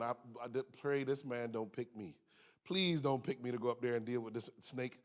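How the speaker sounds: soft, low pleading under his breath; hushed prayer. Retold or imitated. Speaker at the zoo quietly praying the showman won't pick him, hushed plea. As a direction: quietly beg not to be chosen. Silent prayer not to be picked.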